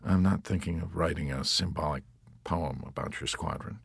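soft and subdued, fading away; mumbled. A narrator's reading. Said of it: "mumbled the chaplain".

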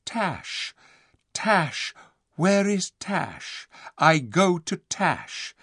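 slow, high-pitched trance-like chant; entranced. A narrator's reading. Recalling a dazed man calling for Tash, entranced. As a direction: intone each phrase as if spellbound. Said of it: "like a man in a trance".

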